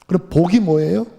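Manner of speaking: ordinary delivery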